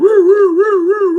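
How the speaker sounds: high loud woo-woo siren wail; mock siren sounds; siren imitation.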